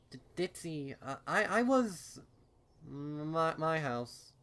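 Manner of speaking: struggling to get the words out; nervous stammer. A narrator's reading. Derpy stammers, upset.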